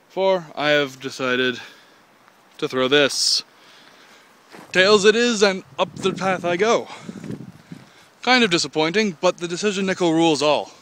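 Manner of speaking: mock grand. Announcing his coin-toss fate to viewers in a mock-heroic voice. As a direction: proclaim it like a hero of legend.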